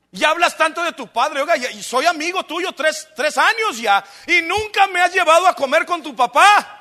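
playfully aggrieved complaint, building; hammed-up annoyance; mock annoyed disciple. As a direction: grumble in mock exasperation, rising at the end. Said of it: "Ya me enfadaste".

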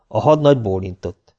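ordinary delivery, nothing marked

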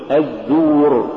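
الزور with light Z and pure long u. Shown as a demonstration. Tajweed teacher giving the form to say, light long u. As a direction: keep the Z of الزور thin. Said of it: "قل".

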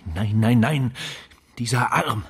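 loud, rising horrified cry; horrified shout. Acted. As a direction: shout it out, recoiling in horror. Entsetzen beim Anblick des Arms. (entsetzt geschrien)

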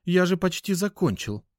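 high-pitched hurt protest; offended. A narrator's reading. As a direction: protest as if wronged. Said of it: "Эдик обиженно хлопнул". (обиженно, высоким голосом)

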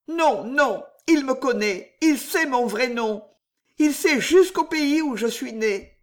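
voix de femme très haute, angoissée; Juliette affolée. Acted. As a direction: insiste dans l'aigu, prise de peur. Narratrice jouant Juliette. (personnage féminin alarmé, haut perché)

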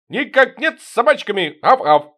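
high loud servile voice with a bark; fawning yap. Acted. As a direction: yap like an eager lapdog. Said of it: "Угодливо изобразил Шоколадный". (угодливо, с тявканьем)

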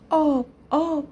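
mild narrated cry for something needed. A narrator's reading. Girl from the orange begs for water, mild high cry. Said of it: "داد زد".

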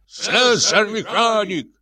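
high, slow mocking cry; shouting. A narrator's reading. Janitor cries out to the crowd, mocking. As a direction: hurl the title like an insult. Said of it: "вскрикивал дворник".

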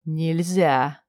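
low, soft, openly amused; mocking quiet refusal. A narrator's reading. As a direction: tease her with a quiet no. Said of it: "негромкое и откровенно надо мной потешающееся". (негромкое насмешливое «нельзя»)

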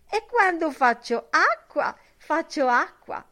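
put-on high, mincing old-woman voice; prim, affected granny telling you her rule. A narrator's reading. Buck Mulligan mimicking a prim old woman at breakfast, high and mincing. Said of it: "con una voce da vecchietta smancerosa".

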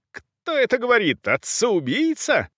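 high-pitched outraged questions; indignant countess, voice shooting up; indignant outburst. A narrator's reading. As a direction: throw it out high, in disbelief and outrage. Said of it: "передёрнуло от возмущения".

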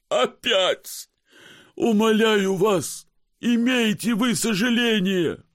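high, slow tearful whine; whining sick passenger. Acted. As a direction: plead like a sick, exhausted man. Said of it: "сделав плачущее лицо".

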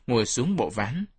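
usual speaking voice

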